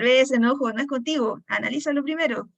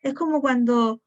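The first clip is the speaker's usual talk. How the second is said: ordinary talk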